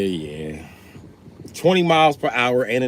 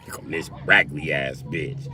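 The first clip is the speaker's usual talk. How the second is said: ordinary delivery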